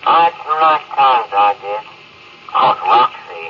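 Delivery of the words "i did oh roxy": ordinary delivery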